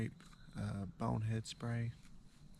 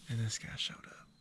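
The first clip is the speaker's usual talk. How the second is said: hushed whisper in the stand. Real, not acted. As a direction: whisper so the deer won't hear. Hunter whispering in deer stand.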